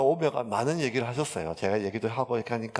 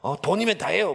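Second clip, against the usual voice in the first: mock angry; 6 semitones higher, 4 dB louder than usual. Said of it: "성질을 내시면서".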